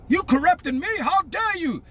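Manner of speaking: how dare anyone correct him; arrogant indignation. Retold or imitated. Imitating the president offended at the lady, arrogant. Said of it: "This is what he's thinking".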